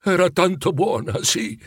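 a touch of emotion in the voice; gently moved, understated. A narrator's reading. Scrooge remembering a kind, lost woman, mildly moved. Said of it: "convenne Scrooge commosso".

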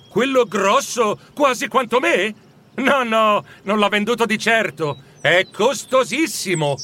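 put-on boy's voice. Boy telling Scrooge about the big roast, animated.

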